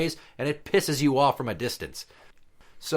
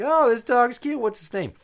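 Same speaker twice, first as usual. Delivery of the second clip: high, loud cooing stranger at the park; 11 semitones higher, 4 dB louder than usual. Retold or imitated. Stranger at the park cooing over a dog, high and loud.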